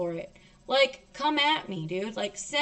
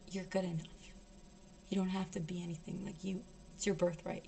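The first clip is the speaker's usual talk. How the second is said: very soft, low, soothing voice; hushed, tender healer's voice; gentle reassurance; 6 semitones lower, 14 dB quieter, faster than usual. Retold or imitated. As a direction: comfort them almost under your breath. Shaman reassuring the speaker.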